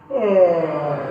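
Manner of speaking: big loud yawning ah; loud demo yawn. Shown as a demonstration. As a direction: stretch out a huge yawn. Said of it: "you all yawn like".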